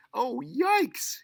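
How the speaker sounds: high, loud alarmed yell. Acted. Mother realizing the markers never come off, panicked yell. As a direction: shriek it in sudden panic. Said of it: "yelled her mother".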